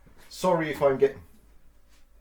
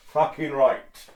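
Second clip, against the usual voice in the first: slow satisfied swear; 2 semitones higher, 9 dB louder, much slower than usual. Real, not acted.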